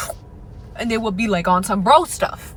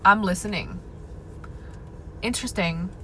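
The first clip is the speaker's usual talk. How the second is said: cool detached interest; 7 dB quieter than usual. Retold or imitated. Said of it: "You should just be letting him know".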